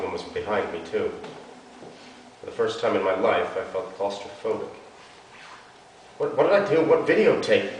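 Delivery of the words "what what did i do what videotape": high, pleading like a scared puppy; a cornered girl pleading with police, panicked. Acted. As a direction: beg them, panicked and small. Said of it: "I was pleading like a freshly trained puppy".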